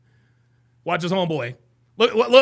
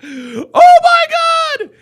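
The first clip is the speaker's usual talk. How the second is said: very slow, much higher, louder wail; drawn-out disbelief shout; 13 dB louder, much slower than usual. Real, not acted. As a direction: stretch it out in shocked delight. Stunned by the clip.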